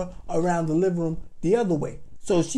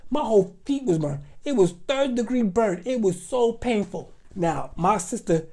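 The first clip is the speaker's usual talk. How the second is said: normal talking voice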